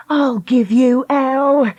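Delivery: slow, biting threat; snapping. Acted. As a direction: snap it, drawn out. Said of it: "Lucy snapped".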